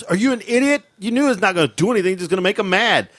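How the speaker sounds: high, scolding mock wife; wife scolding husband, high; exasperated wife. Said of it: "my wife goes".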